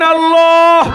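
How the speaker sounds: high, loud shouted name. Shown as a demonstration. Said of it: "আর একবার বলেন".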